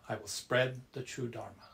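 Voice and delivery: ordinary talk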